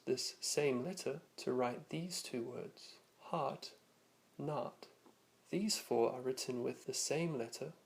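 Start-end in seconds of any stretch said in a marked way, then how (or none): 3.2-4.8 North American "heart, not", meaning hot, with an open ah; North American vowel. Shown as a demonstration. Teacher showing North American speakers' vowel, American ah in "heart, not". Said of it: "many North American speakers may also use".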